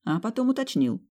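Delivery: ordinary delivery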